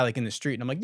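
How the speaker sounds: ordinary talk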